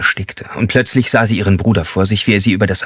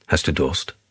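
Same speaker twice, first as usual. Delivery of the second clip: soft, lowered soothing question; suddenly gentle, softer; comforting; 3 semitones lower, 5 dB quieter than usual. Acted. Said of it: "Er klang tröstlich". (plötzlich tröstlich und leise)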